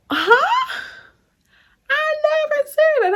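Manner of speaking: high squeaky disbelieving huh; shocked disbelief. Retold or imitated. Telling coworkers, shocked at the lie. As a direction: sound floored at the accusation. Indignant retelling of being misquoted.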